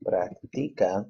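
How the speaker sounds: Practica drawn out slowly; slow demo word. Shown as a demonstration. Teacher slowing down the command word. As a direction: spell out Practica slowly for learners. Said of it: "make that into command".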